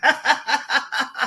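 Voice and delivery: easy shared laugh; warm laugh. Real, not acted. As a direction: laugh along with your friend. Laughs at tea advice.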